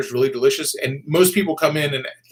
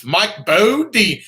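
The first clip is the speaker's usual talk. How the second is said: slow, high Southern drawl on Bodine; Southern accent; 7 semitones higher, 5 dB louder, much slower than usual. Shown as a demonstration. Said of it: "I dare you to say Bodine without a Southern accent".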